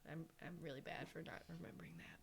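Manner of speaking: sheepish whisper under her breath; whispered self-deprecating aside. Real, not acted. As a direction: drop to a whisper, a little embarrassed. Embarrassed aside about forgetting.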